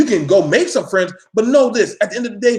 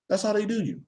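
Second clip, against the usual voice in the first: ordinary talk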